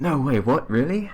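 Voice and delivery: high, disbelieving "no way"; surprised. Real, not acted. Hears a surprising claim.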